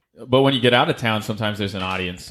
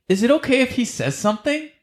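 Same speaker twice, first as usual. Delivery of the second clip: high, chirpy imitation of the host; lightly mocking high voice; mock host lady; 13 semitones higher than usual. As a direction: toss it off in a much higher put-on lady voice. Host asks to let stranger talk.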